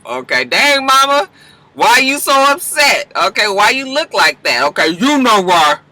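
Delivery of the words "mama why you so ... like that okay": usual speaking voice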